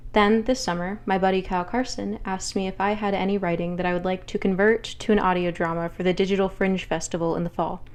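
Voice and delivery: usual speaking voice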